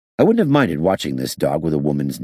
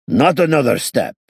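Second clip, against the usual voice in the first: stern and loud, pitched up; firm warning, raised voice; 9 semitones higher, 5 dB louder than usual. A narrator's reading. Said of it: "he said sternly".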